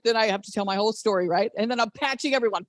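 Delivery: ordinary talk, nothing marked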